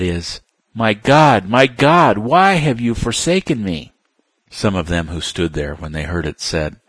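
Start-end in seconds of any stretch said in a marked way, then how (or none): 0.7-3.9 loud, high desperate cry; anguished cry. Acted. Translating the cry.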